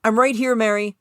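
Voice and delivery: Jerry calling back to Mary across the theater. Acted. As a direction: call across the seats to her. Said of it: "he called out".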